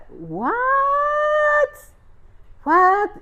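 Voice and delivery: long, soaring high "what"; hammed-up, high-pitched shock; flabbergasted. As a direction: stretch it out high in total disbelief. Climax of shocked retelling.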